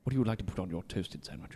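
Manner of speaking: low, soft suave actor voice; mock-seductive star in a deep murmur; Benedict Cumberbatch. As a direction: ask it low and intimate, as a sultry impression. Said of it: "Watch too much Sherlock".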